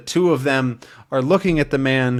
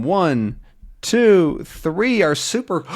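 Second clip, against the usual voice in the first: ordinary delivery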